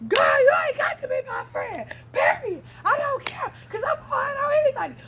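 put-on shouting girl ending the friendship; furious shout. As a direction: scream that you're done with her.

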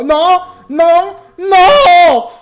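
very high, loud repeated shrieks; panicked screams. Real, not acted. Zombies breaking in.

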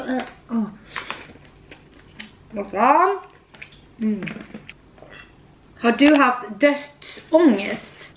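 chewing a big bite; talking through food; mouth full. Real, not acted. Seen 2: Bites large roll at 0.4, then chews with full mouth throughout.

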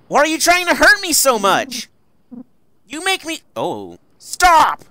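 high, loud, desperate accusations; loud, high anguished pleading; anguished shout. Acted. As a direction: hurl it at him like a betrayed daughter.

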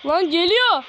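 high, loud warning cry; frightened warning to the group; alarmed. Real, not acted. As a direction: shout it in rising panic. Something approaches in game.

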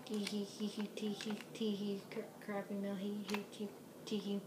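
soft, silly tee-hee giggle; spelt-out giggle. Pretend. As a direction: giggle tee hee, under your breath. Giggling at own joke.

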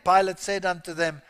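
ordinary delivery

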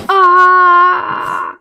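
loud high frustrated yell; frustrated scream. Real, not acted. Falling in parkour game.

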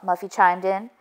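plain reading voice

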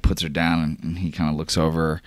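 normal talking voice

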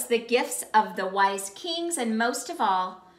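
ordinary delivery, nothing marked

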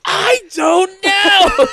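high, slow shout cracking with laughter; can't stop laughing. Real, not acted. Friend teased for laughing at a movie he hated, shouting through laughter. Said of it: "now you're dying laughing about them".

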